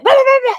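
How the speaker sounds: loud, high-pitched blabbering; imitation of compulsive butting-in; mock interrupting babble. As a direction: burst in with noisy nonsense chatter. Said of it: "a really bad problem interrupting people".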